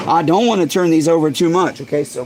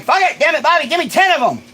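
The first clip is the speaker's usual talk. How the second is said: exasperated; 10 semitones higher, 5 dB louder than usual. Retold or imitated. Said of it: "I was like".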